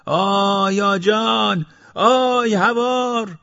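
very high, long-drawn cry for help; heartfelt scream. A narrator's reading. Bloodied worker screaming for police, long high wail. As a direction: scream it from the gut, stretching each word. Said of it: "با تمام وجودش از بند دل فریاد زد".

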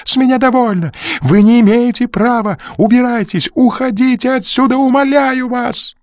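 high, loud pleading screams; desperate yelling. Acted. Crew begging the visitors to go, loud and panicked. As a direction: scream at them to leave. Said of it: "заорал Крю".

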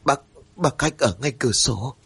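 halting start, a touch of fright; panicked. A narrator's reading. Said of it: "Mụ mới nói bằng một giọng rất khoáng".

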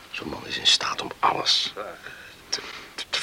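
whisper. Acted. Talking in bed at night.

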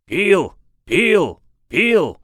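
high, loud rallying chant; high-pitched partisan yell; answering crowd shout. A narrator's reading. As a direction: shout the name back at the rival side. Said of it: "дружно откликнулись".

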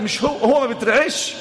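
normal talking voice

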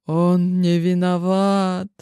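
ordinary delivery, nothing marked

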